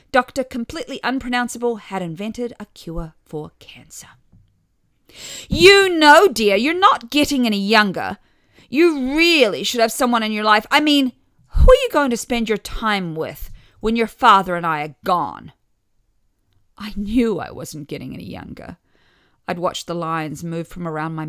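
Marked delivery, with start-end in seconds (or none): 5.5-15.5 much higher and much louder, sweetly pointed; needling mother. A narrator's reading. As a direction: rub the salt in with a smile. Mother lectures daughter.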